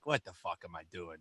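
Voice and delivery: low, hushed self-talk; muttering to himself. Retold or imitated. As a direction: mutter it under your breath, deflated. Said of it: "I'm just like".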